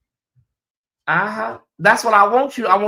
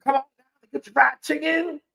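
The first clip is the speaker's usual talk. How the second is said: mock [name] ad; 7 semitones higher, 4 dB quieter than usual. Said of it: "but damn, girl".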